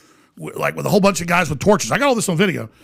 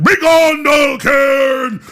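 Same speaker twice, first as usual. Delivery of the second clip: booming, high ritual shout; imitated thundering banishment cry; Walter Cronkite; 9 semitones higher, 6 dB louder, much slower than usual. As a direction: bellow it with long drawn-out words. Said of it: "it was the voice of Walter Cronkite".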